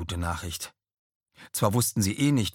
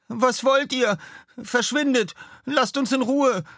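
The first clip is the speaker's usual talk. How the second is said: much higher, louder frantic threats; angry shouting; 5 dB louder than usual. Acted. Florian waves his sword at the stranger, shrill angry shouts. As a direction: yell him away in scared fury.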